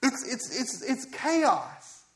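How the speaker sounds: high, loud, drawn-out appeal to God; prophet's outcry. Acted. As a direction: cry out at the state of the world. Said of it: "And he's just saying".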